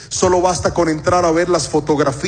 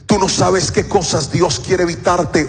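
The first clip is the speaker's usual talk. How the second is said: normal talking voice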